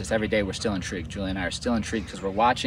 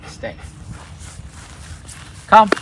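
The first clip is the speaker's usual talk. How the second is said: ordinary talk, nothing marked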